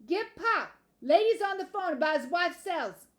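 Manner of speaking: high, loud holler; yell. A narrator's reading. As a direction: yell away from the phone to someone inside. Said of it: "moved the phone away from his mouth and yelled".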